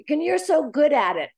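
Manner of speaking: butter them up to get a favor; flattering plea. Retold or imitated. Someone coaxing her to do it for them, gushing. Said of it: "Blow smoke right up my ass".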